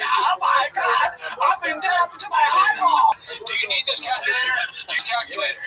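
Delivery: hammed-up desperate seller shouting; mock frantic yelling. Dad yelling at the kids to buy calculators, mock frantic yell. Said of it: "He's yelling at us".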